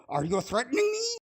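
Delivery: high mocking whine; mock high-pitched accusation; mocking quote. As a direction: parrot them in a high mock voice. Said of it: "They go".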